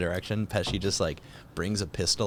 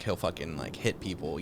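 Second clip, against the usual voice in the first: ordinary talk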